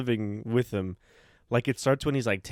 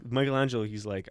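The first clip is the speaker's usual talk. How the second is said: usual speaking voice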